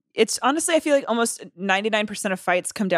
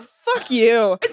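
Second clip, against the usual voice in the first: ordinary talk, nothing marked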